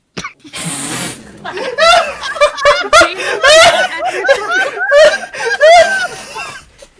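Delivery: huge high shrieking laugh. Real, not acted. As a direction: scream with laughter. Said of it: "Oh my God".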